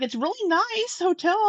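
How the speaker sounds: high-pitched, insistent praise; retold insistence. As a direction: push the pitch right up to reassure. Retold reassurance about the hotel.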